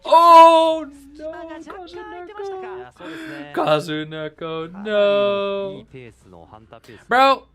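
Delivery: high loud despairing cry; anguished no. Real, not acted. Streamer watching a teammate get caught, anguished cry. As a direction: wail as the teammate goes down.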